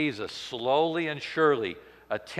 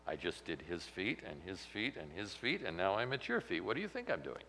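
softer, lower sarcastic reply; deadpan duh; 5 semitones lower, 7 dB quieter than usual. Acted. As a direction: answer the obvious question, understated and dry. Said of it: "It's kinda like, "Duh".

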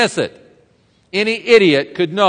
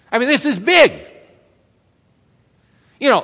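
higher, animated preaching; rising excitement; 4 semitones higher than usual. Acted. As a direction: build the point with growing energy. Animated sermon build-up.

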